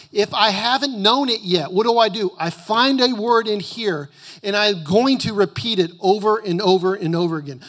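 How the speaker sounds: high, loud emphatic preaching; booming motivational speech. Acted. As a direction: preach it hard and rising. Preaching at full force.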